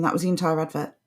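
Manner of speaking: normal talking voice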